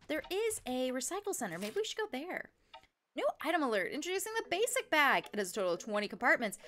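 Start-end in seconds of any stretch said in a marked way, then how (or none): 3.2-6.6 punchy infomercial pitch; lively product-launch announcement; announcer ad read. Acted. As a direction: sell it like a shopping-channel advert. Reads item advert in announcer style.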